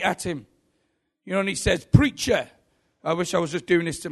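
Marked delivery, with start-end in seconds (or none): 1.9-2.5 higher, mildly mocked-up verbal attack; challenging call to an opponent; confrontational challenge. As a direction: lightly act out an attacker going straight at someone. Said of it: "this young lad attacks him".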